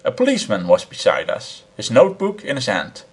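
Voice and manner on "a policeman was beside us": plain reading voice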